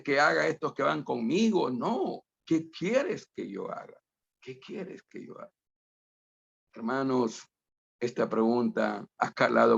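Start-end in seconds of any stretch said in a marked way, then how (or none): 4.4-5.5 hushed breathy whisper of the question. Shown as a demonstration. Preacher whispering the question to himself, almost silent. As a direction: whisper it as a private prayer.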